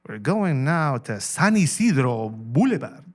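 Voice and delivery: slow, loud TV reporter voice with exaggerated Spanish place names; hammed-up LA news report. Shown as a demonstration. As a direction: roll out San Isidro Boulevard like a newscaster. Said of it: "like a L A T V reporter".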